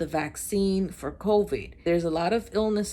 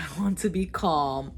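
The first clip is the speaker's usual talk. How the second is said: usual speaking voice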